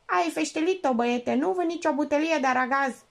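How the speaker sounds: lightly voiced squawking parrot. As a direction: caw it out like a parrot. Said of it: "Papagalul Cheops cârâia".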